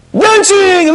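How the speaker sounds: usual speaking voice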